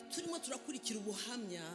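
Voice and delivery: usual speaking voice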